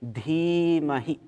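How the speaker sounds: "Dhimahi" with DHI held long, HI short; long first I, short last. Shown as a demonstration. Teacher giving students a mantra word, "Dhimahi" with long first I, short last. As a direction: stretch the first I of "Dhimahi", clip the final one. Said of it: "it's long, and the second I is short".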